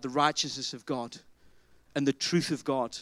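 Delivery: ordinary delivery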